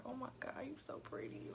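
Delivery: hushed and tender, softly; tender whisper. Retold or imitated. Mother whispering softly to her newborn baby, tender. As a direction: breathe it to the newborn in awe.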